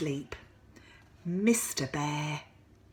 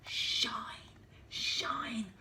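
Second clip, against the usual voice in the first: whisper; 5 semitones higher, 5 dB quieter than usual. Acted. Sun whispered.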